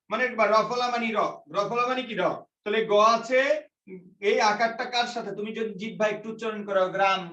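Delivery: normal talking voice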